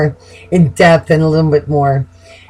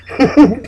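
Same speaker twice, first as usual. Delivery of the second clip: high laugh; 7 semitones higher than usual. Real, not acted. Laughs about Aries.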